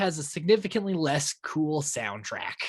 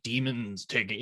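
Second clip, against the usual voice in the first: ordinary talk, nothing marked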